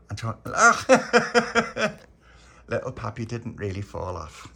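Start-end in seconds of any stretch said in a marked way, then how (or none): 0.4-2.0 unvoiced wheezing giggle, loud; breathy high laugh. Real, not acted. Storyteller cracking up at his memory, wheezing laugh. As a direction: laugh silently through the breath.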